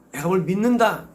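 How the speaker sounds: ordinary delivery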